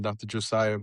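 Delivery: ordinary talk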